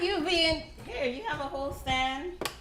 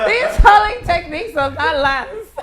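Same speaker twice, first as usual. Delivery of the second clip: louder, laughing hard; 5 semitones higher, 15 dB louder than usual. Real, not acted. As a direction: shout it through laughter. Louder repeat through laughter.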